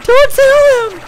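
put-on high, loud frantic kid plea; little boy begging in a high loud voice; panicked little boy. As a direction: squeak it out like a scared kid. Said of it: "and he's like".

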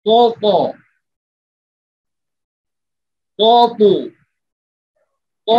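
high, loud emphatic ক ক drill; drilled letter pairs. Shown as a demonstration. Teacher drilling students on qaf with two vowels, emphatic. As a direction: bounce each syllable out for the class. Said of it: "দুটা করে হারাকাত দিয়ে".

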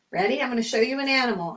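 ordinary talk, nothing marked

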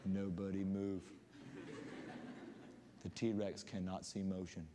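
low hushed warning, holding in a laugh; scared hush. Retold or imitated. Kids hushing each other when Dad's vein pops, scared hush. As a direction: freeze and hush like prey. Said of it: "you were like".

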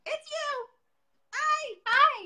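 high, loud, delighted hello; excited greeting. Real, not acted. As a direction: squeal a welcome to a friend. Host greets guest coming on live.

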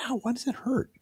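high, soft mock ouch; retold puzzled pain. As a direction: act out confused soreness, softly and high. Retold puzzled pain next morning.

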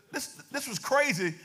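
ordinary talk, nothing marked

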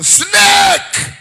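high, loud cry of snake; alarmed shout. Shown as a demonstration. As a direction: scream it as if one is at your feet. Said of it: "If I shout right now".

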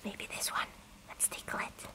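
unvoiced secretive whisper. Real, not acted. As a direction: whisper it so the plant won't hear. Sneaking up on a plant.

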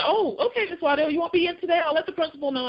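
high, quick, cheerful secretary voice; chirpy secretary. Retold or imitated. Secretary answering the phone to Miss Waddell, high and chirpy. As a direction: play the bright office secretary.

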